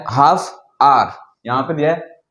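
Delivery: ordinary talk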